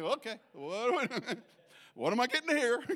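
brace yourself, half laughing; wary laughing dread. Retold or imitated. Preacher bracing as Greg starts correcting him, laughing.

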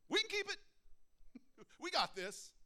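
high, loud cocky boast; mocking Israelites. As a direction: ham up the overconfident crowd. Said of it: "What did they do".

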